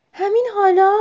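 high, loud anxious question; worried question. A narrator's reading. Owlet asks mother if it must go now, worried. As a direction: ask anxiously, pitch lifting sharply. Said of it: "با نگرانی گفت".

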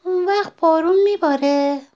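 high, small-creature voice, projected; little sapling asking. A narrator's reading. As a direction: ask hopefully as the young sapling. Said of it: "نهال آهسته گفت".

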